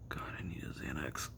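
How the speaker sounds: whispered to himself, worn out; hushed, tired whisper; weary whisper. Real, not acted. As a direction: breathe it out under your breath, drained. Alone, weary, craving a Xanax.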